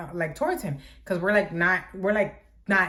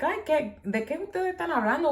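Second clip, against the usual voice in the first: grandma voice; 8 semitones higher than usual. Retold or imitated. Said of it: "My grandma's like".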